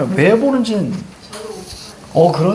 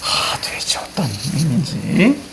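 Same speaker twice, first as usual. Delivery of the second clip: whispered aside; 2 semitones lower, 4 dB quieter, slower than usual. Real, not acted. Baffled aside after chalk claim.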